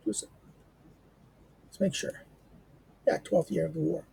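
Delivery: usual speaking voice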